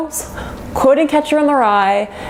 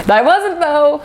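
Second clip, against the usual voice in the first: squeaky, much higher, teasing; playful high denial; 8 semitones higher, slower than usual. Real, not acted. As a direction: pipe up, much higher, with a grin.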